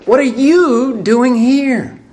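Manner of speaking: "you" stretched high and loud; stress on you. Shown as a demonstration. Preacher demonstrating God's question, stress on "you". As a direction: lean long and hard on "you". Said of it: "accent the others as you read the question".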